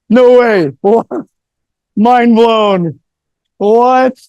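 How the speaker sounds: loud, high, hammed-up astonishment; mock amazement. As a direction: act totally floored, playing it up. Mock amazement at his talk running over.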